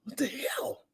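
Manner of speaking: a breathy whispered what the hell; whispered aside. Retold or imitated. After the vagina insult, a whispered aside. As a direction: drop to a whisper, half to yourself.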